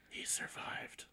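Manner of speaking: tiny whispered bug voice; cockroach voice. Acted. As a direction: hush it like a little surviving roach. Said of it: "He has a great voice for a cockroach".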